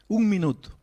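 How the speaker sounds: Un minuto punched high and loud; preacher stressing "Un minuto" with force; emphatic. Acted. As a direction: hammer home "Un minuto". Preacher hammering his point.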